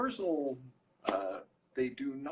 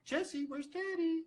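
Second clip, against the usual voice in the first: much higher coaxing parent voice, asking twice. Retold or imitated. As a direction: coax a toddler to point out her dad. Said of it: "And then".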